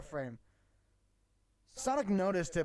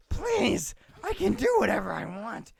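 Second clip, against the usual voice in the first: high, loud hammy defiance; loud defiant squeal; 5 semitones higher, 8 dB louder than usual. Acted. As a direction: shout it like a brat. Acting Ahsoka defiant.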